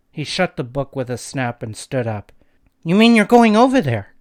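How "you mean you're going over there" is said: higher, louder shocked outburst; horrified cry. A narrator's reading. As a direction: cry out in disbelief. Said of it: "cried Dina, horrified".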